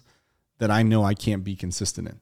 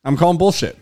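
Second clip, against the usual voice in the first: higher, louder call-out; 7 semitones higher, 5 dB louder than usual. Real, not acted. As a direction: call bullshit loud and high. Calling bullshit twice.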